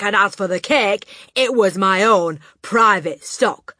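loud, relentless berating; furious tirade. Acted. Headmistress berating the boy about her cake. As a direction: rant at him without pause.

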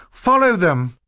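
high loud command; exclaiming. A narrator's reading. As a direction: urge them on. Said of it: "Violet exclaimed".

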